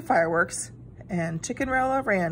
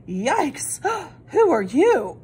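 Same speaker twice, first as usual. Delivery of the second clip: a startled hen gasping at a stranger; 6 semitones higher, 4 dB louder, much slower than usual. A narrator's reading. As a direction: gasp in fright, then ask slowly. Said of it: "gasped Chickenrella".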